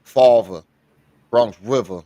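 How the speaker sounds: mock Bronx pronunciation with dropped R; exaggerated New York accent. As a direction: imitate a heavy New York accent, low. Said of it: "and, and say it like that".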